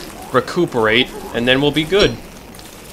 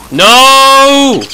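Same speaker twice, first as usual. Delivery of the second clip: loud, much higher cry of no; loud high wail of no; anguished shout; 11 semitones higher, 7 dB louder than usual. Real, not acted. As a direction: yell it in dismay. Losing loot.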